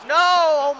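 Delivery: loud, high cry of dismay; sudden loud protest; alarmed shout. Real, not acted. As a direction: yell out as danger strikes. Alarm at opponent's shot.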